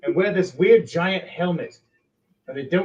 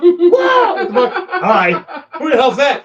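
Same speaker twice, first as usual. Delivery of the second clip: loud, much higher alarmed yells; startled shouting; 11 semitones higher, 11 dB louder than usual. Acted. Attackers seeing orcs sprout from mushrooms, startled shouting. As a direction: shout in shock at the monsters.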